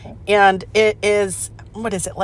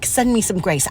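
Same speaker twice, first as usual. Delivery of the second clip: normal talking voice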